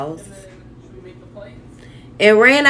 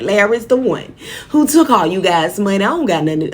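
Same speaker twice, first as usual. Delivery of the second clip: ordinary talk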